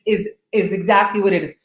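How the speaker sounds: ordinary delivery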